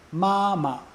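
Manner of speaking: "ma, ma" as high level syllable, then short neutral one; high steady "ma", brief unstressed "ma"; first then fifth tone. Shown as a demonstration. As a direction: hold the first "ma" high, drop off on the second. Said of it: "that's first tone".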